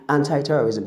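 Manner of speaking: ANTI-TERRORISM said as "AN-tie-terrorism"; "eye" ending on ANTI in ANTI-TERRORISM; American pronunciation. Shown as a demonstration. Teacher showing what to say to Americans, American pronunciation. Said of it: "that's how they render".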